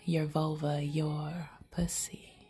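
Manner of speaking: ordinary talk, nothing marked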